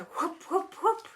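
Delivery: plain reading voice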